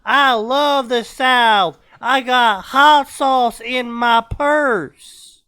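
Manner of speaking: slow, loud, high put-on Southern accent; Hillary Clinton. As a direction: lay on a pandering Southern accent, loudly. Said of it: "And with that accent".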